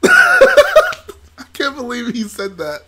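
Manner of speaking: high, slow words wheezed through laughter; can barely speak for laughing. Real, not acted. Streamer cracking up over a movie line, laughing.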